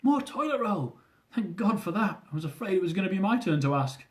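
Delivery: high, relieved exclamation; relieved Rue. A narrator's reading. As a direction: sound relieved.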